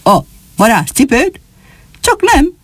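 high-pitched disbelief, climbing sharply at the end; astonished exclamation. Acted. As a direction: react amazed, voice leaping upward. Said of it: "úgy kiáltott fel a meglepetéstől". (meglepett, hitetlenkedő felkiáltás)

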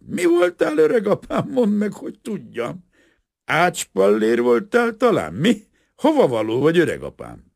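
booze-happy, sloppy cheer; drunk worker. A narrator's reading. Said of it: "fátyolos szemmel". (részeg, hangos, vidám ácslegény)

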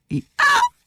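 very high squealed hee-haw; donkey bray. A narrator's reading. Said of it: "Füles úr pedig csak ennyit felelt". (éles, magas szamárordítás)

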